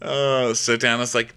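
normal talking voice

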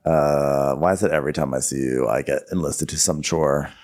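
low, subdued grumble; mock put-upon grumble. Neighbor answering a request for help, low mock grumble. Retold neighbor's weary reply.

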